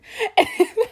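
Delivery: short laugh breaking high; laughing at mom's reaction to the price. Real, not acted. Laugh after mom's reply.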